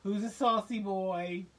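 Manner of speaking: put-on loud, slow gushing baby voice; doting dog talk. She gushes over a snarling pit bull in loud slow baby talk. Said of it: "I'd be like".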